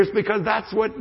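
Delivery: ordinary delivery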